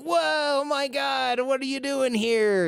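high, slow, stunned exclamation; amazed. Retold or imitated. Michigander abroad meeting another, slow and high with amazement. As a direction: gush over it like a miracle.

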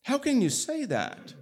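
escalating indignant outrage. Acted. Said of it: "he's pretty upset and surprised".

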